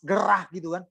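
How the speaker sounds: usual speaking voice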